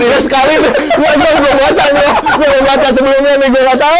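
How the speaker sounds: high, loud laughing excuses. Real, not acted. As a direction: laugh it off after the performance. Relieved after the announcement. (tertawa lega)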